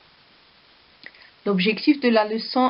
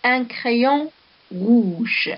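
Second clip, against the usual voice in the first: Un crayon rouge said very slowly in one breath; teacher reading the example sentence very slowly; 3 semitones higher, much slower than usual. Shown as a demonstration. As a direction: draw out the whole phrase Un crayon rouge for the class. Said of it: "Ici, nous avons un exemple".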